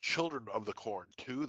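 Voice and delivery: ordinary talk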